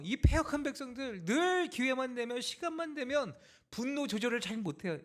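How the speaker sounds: high, impassioned sermon delivery; forceful preaching. Acted. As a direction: preach it with rising conviction.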